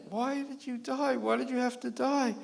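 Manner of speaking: mock widow's wail again; piteous crying. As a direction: keep lamenting at the tombstone. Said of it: "piteously crying".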